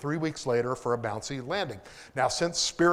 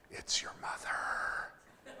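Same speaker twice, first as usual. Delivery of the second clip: whispered; 12 dB quieter, much slower than usual. Retold or imitated. Coworker passes phone, hushed.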